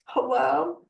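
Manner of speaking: high, loud, questioning call; sing-out high greeting, re-enacted; tentative hello. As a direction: call out as if into the unknown. Retold wary return to the records.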